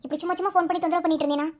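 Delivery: normal talking voice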